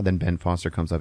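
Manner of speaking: ordinary delivery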